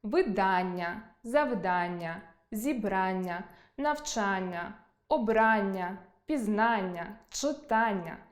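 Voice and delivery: slow, low word list, each stressed on «а» of «ання». Shown as a demonstration. Teacher listing examples of the pattern, stressing «а» in each «ання». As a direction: land on the «а» in «Видання», «завдання», «читання», one word at a time. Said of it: "мають наголос на «а»".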